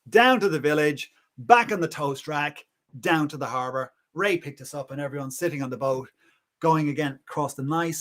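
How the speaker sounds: plain reading voice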